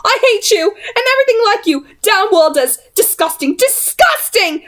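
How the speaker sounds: high, thin, trembling shriek. A narrator's reading. Jessamine shrieks at the goblin, high and trembling. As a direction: shriek it with shaking hatred. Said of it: "Jessamine shrieked, her voice thin and trembling".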